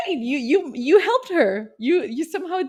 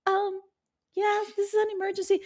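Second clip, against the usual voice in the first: high, small pitiful voice; mock pitiful caller; 9 semitones higher, 5 dB quieter than usual. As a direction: play the heartbroken caller, meek. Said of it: "I can imagine the call".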